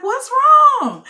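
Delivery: high, anxious group question; imitated alarm. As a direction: mimic worried onlookers calling out. Imitates everybody asking in alarm.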